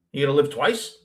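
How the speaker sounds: ordinary talk, nothing marked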